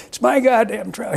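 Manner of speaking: high, mildly outraged grumble; indignant old man. Retold or imitated. Quoting the old vet angry about the ticket, mildly outraged. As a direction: defend his own trout, half-joking. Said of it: "He goes".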